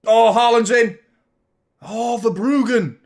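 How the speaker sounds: high, very loud match reaction; real roar of a watching fan; excited shout. Real, not acted. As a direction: yell it out as the chance opens up. Live goal-chance reaction to match.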